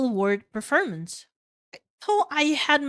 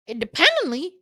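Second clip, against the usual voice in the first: incredulous snort; 6 semitones higher, 4 dB louder than usual. Acted. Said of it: "I snort".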